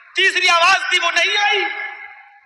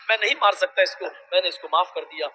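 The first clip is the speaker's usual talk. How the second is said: tender forgiveness; 8 semitones lower, 6 dB quieter, faster than usual. Retold or imitated. Prophet forgives softly.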